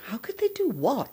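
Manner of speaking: high puzzled echo, rising mid-phrase; retold bewildered query; baffled question. Retells her baffled answer to husband.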